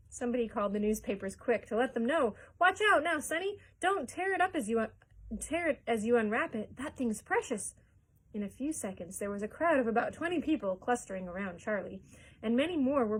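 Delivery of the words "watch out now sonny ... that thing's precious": high, loud urgent caution; excited warning. A narrator's reading. Shopkeeper warns Charlie not to tear the ticket, excitedly. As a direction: warn him before he tears it.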